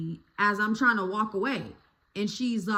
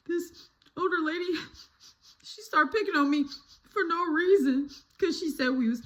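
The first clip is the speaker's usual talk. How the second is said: put-on higher, fast whiny kid voice; mock crying kid; 7 semitones higher than usual. Girl tearfully telling her mom about the neighbor.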